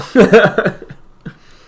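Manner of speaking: short, loud, high laugh; real burst of laughter. Real, not acted. Laughs at own joke about business.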